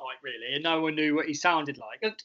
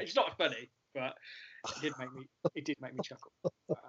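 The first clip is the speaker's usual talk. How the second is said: ordinary delivery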